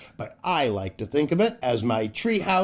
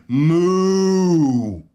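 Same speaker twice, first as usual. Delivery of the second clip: louder put-on moo for the punchline; mock cow moo; 6 dB louder than usual. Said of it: "does a raccoon say".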